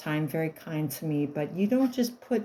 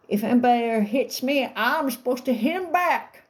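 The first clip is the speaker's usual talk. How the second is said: higher, put-on voice of a small boy; disguised little boy; 5 semitones higher, 4 dB louder than usual. Little husband answering his teacher, in a put-on childish voice. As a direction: play the calm little boy, voice changed. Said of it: "but I'm disguising his voice".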